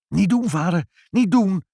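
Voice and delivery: high, loud urgent plea; crying out. A narrator's reading. Mother and child stopping father breaking the umbrella, crying out. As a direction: call out to stop him. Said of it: "riepen moeder en Ibbeltje".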